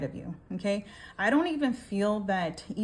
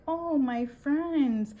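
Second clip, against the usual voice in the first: higher, drawn-out mock greeting to friends; film villain quote; 6 semitones higher, much slower than usual. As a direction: stretch it out slowly, acting the end scene. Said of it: "Where he's like".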